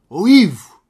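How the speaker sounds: acted holler of a name; calling out. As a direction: call a friend over from afar.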